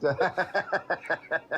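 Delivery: long run of quick chuckles; amused, rapid-fire laughing; real laugh. Real, not acted. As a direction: burst out in staccato laughter. Laughing at his own remark.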